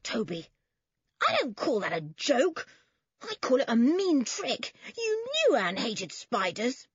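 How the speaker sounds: high, outraged scolding; indignant anger. A narrator's reading. Cousin rounds on Toby over the mean trick, indignant. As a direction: fling it back in rising indignation. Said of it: "her face growing crimson".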